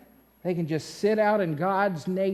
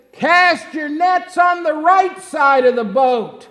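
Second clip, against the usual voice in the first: loud raised shout across water; calling out; 5 semitones higher, 7 dB louder than usual. Acted. Man on shore to fishermen at sea, calling out. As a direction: call it out from the shore. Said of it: "he calls out to them".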